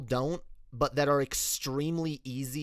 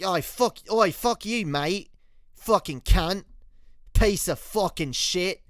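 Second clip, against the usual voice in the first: higher, hammed-up Aussie swearing; Australian accent; 5 semitones higher, 5 dB louder than usual. Shown as a demonstration. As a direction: curse in a broad Australian accent. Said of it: "slip into Australian constantly".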